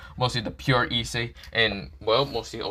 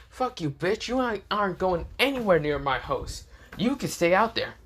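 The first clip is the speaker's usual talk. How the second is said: high-pitched, indignant character voice; acted heated brush-off; defiant refusal; 8 semitones higher than usual. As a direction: lay down the law, pitched high. Shiro refuses entry in told quarrel.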